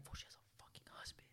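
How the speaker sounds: imitated friend's urgent whisper; barely audible, all breath, confiding; secretive whisper. Friend secretly passing on the news.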